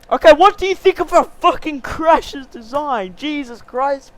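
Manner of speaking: high loud exasperated yell; exasperated shout. Real, not acted. Host fed up with his off-topic friend, exasperated shout.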